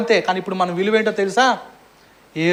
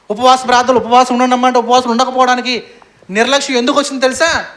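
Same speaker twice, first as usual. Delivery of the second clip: building sermon; 2 semitones higher, 4 dB louder than usual. Acted. Preaching building.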